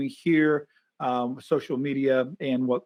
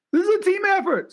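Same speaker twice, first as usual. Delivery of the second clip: high, bright mock sister voice, a bit louder; sister's voice; 4 dB louder than usual. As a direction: pitch it way up to play the sister-in-law. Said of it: "his sister's like".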